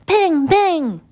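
loud high imitated gunshots; bang bang gunshot. Storyteller imitating the thief's gun, loud bangs. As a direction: punch out two sharp gun bangs.

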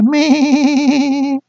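high comic goat bleat; sheep bleat. Acted. (高声学羊叫)

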